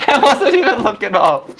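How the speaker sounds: laughing through the words; giggly, high and breathless. Real, not acted. Laughing at bear attack.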